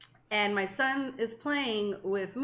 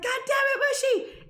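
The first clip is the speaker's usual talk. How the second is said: high frustrated yell at the dog; exasperated yell. Retold or imitated. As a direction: curse the runaway dog from the doorway. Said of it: "and I'm like".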